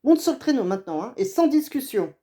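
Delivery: no arguing, get on now; angry order. Acted. Exasperated hunter orders his son onto the sled, loud and stern.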